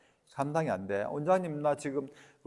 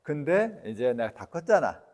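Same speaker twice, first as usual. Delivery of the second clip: ordinary talk, nothing marked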